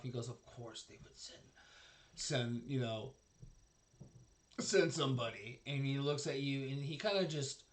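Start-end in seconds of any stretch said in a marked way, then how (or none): 0.3-5.6 scoffing to himself. Acted. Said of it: "he kinda laughs to himself".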